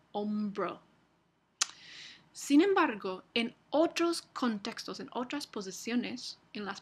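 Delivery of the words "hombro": hombro with a fully stopped b after the m; occlusive b after nasal. Shown as a demonstration. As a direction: hombro with a hard b, lips closed. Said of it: "se realiza como oclusiva".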